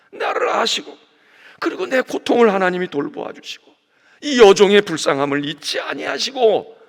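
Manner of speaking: loud pleading prayer; fervent cry. Acted. Preacher voicing Hannah, loud fervent plea. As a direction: cry out to God for mercy.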